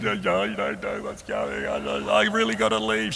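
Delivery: high, drawn-out comic wail; hammed-up crying while trying to talk; imitated blubbering. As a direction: blubber the words in a high mock sob. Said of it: "I'm a blubberer, not a weeper".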